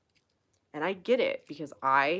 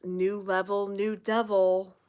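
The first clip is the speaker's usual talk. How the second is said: mock mantra quote; 2 semitones higher, 4 dB louder, slower than usual. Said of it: "one of my favorites".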